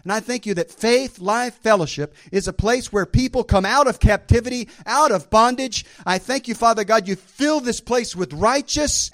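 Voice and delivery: loud, rising, fervent preaching prayer; declaration prayer. Shown as a demonstration. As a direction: proclaim it boldly over the church. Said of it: "sounds something like this".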